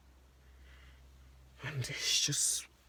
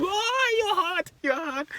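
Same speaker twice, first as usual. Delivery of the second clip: very high, loud excited cry; reenacted high-pitched hype; thrilled reaction; 8 dB louder, slower than usual. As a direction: exclaim like an overexcited fan. Retells his reaction to the song.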